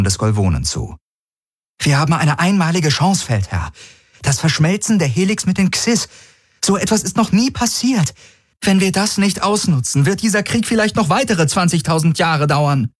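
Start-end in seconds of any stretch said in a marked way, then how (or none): 1.8-12.9 higher voiced young woman, urgent and persuasive; earnest Kirai. A narrator's reading. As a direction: press the warlord to seize the chance. Kirai pleads with the Golvone.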